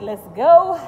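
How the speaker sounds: a loud, eager "let's go"; excited. Retold or imitated. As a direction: fire up the friend's call to the gym. Friend's gym invite, excited.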